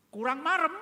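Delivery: lightly voiced Jesus pressing Thomas to look; a touch of Jesus daring the doubter; Jesus challenging. Jesus voiced offering wounds.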